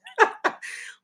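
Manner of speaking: amused burst of laughter; real laugh between remarks. Real, not acted. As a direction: laugh at the absurdity. Laughs at the Iowa voters.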